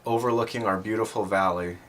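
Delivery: ordinary delivery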